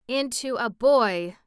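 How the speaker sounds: ordinary delivery, nothing marked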